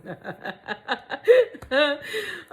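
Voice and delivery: long, high, loud laughing fit; helpless high-pitched cackling; real hearty laughter. Real, not acted. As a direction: crack up completely. Cracks up at the story.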